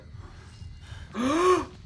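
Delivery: high gasp of fright; sharp frightened gasp. Real, not acted. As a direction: gasp in sudden fear. Monster coming back.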